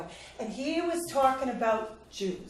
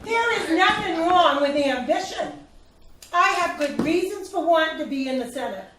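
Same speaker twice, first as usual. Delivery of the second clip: raised, louder and higher, insisting; heated defense; 5 semitones higher, 5 dB louder than usual. Acted. To her advisor, defending her Senate run, raised insistent voice. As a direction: push back hard, voice up.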